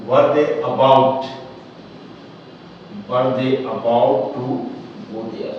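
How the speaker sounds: teacher spelling out the question; slow model question. Shown as a demonstration. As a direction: read the question word by word. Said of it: "फिर से पढ़कर सुना रहा हूं".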